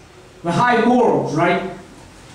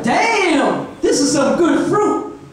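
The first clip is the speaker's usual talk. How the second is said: higher, drawn-out mock relish; delighted Eve; 6 semitones higher, much slower than usual. Eve tasting the apple, delighted and drawn-out. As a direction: savour it in a higher voice.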